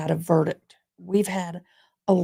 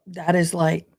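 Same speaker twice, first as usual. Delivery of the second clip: usual speaking voice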